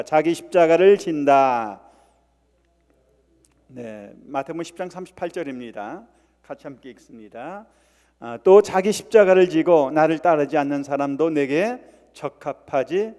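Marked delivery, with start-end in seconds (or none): 0.0-1.8 slow, loud, emphatic declaration; loud repeat-after-me. Shown as a demonstration. Leads congregation in repeating phrase. 8.4-13.1 verse proclaimed at full voice; loud scripture reading. Acted. Leads congregation reading scripture aloud.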